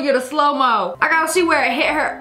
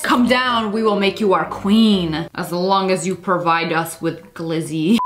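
fish voice; 10 semitones lower than usual. Pretend. Voicing the fish to the woman.

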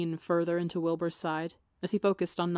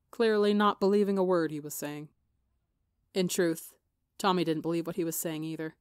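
usual speaking voice

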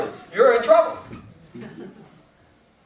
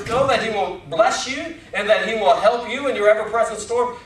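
usual speaking voice

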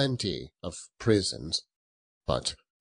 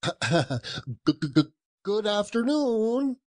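put-on high stammering old man; sputtering old miser; 13 semitones higher than usual. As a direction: splutter it out in a huff. Scrooge sputters dismissal.

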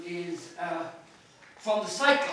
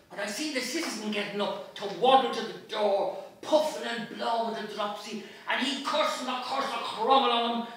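raised, lively pub-tale reading; Dublin story narration; 3 semitones higher, 6 dB louder than usual. Acted. Reading the Cyclops episode aloud, lively pub narration. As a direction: tell it like a Dublin barfly.

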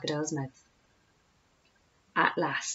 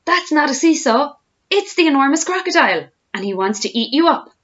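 high, loud frantic warning; monkey's urgent alarm; shouted warning; 9 semitones higher, 6 dB louder than usual. A narrator's reading. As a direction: shout to save the children. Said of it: "Mugglewump shouted to the children".

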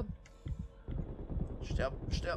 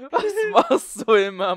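laughing exasperation; 7 semitones higher, 11 dB louder than usual. Real, not acted. Teammate died again.

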